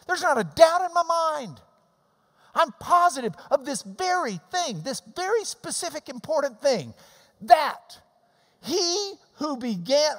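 high, loud preaching build; fervent preaching. Acted. Preacher urging congregation to pay attention, fervent. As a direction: drive the point home to the flock.

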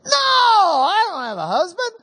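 high, loud "No" in a mock woman's voice; the Samaritan woman brushing him off, pitched high; defensive woman. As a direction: play her quick, defensive denial. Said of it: "She says".

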